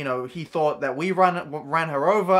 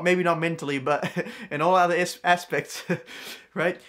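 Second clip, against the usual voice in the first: ordinary delivery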